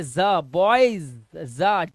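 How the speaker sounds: बॉयज drawn out slowly, its ज a separate "za"; buzzed Z sound. Shown as a demonstration. Teacher isolating the sound for pupils, drawn-out buzzed z. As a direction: stretch बॉयज into a loud "boyzzz-za". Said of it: "जा का sound आ रहा है".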